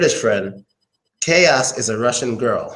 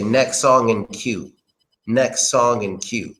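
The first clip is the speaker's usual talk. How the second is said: slow demo; slower than usual. Shown as a demonstration. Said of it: "So I'll say".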